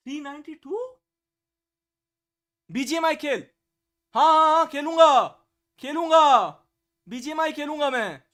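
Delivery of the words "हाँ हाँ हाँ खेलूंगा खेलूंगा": loud insistent exclaiming; emphatic yes. Real, not acted. Streamer agrees to play BGMI, loud and insistent. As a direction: declare it loudly, word after word.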